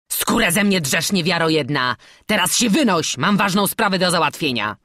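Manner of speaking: loud, irritated brush-off; annoyed and forceful; gruff dismissal. A narrator's reading. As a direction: send the trader packing, loudly. Robber throws the merchant out.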